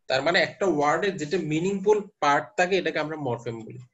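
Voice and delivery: ordinary talk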